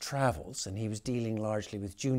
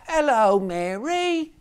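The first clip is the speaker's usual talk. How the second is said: much higher, louder cheery greeting; cheerful boy; 12 semitones higher, 10 dB louder, slower than usual. A narrator's reading. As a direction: greet her brightly like a cheeky boy. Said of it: "he said cheerfully".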